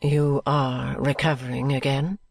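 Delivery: low, slow, measured noblewoman's voice; a proud lady softening her tone with care; Lady Dedlock speaking gently to Miss Summerson; Lady Dedlock, kind but composed. A narrator's reading. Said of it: "she asked kindly".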